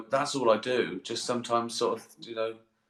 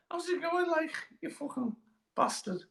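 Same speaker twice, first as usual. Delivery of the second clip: high, soft Scouse patter; Scouse accent; 6 dB quieter than usual. Shown as a demonstration. Comedian persisting with bad impression, high soft Scouse accent. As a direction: squeak out mock-friendly Scouse, quietly. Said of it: "can you do a Scouse accent?".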